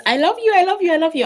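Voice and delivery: high, loud outpouring of love; emotional, high-pitched gushing; gushing affection. Real, not acted. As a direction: gush it out in a rising rush of feeling. Gushing thanks through tears.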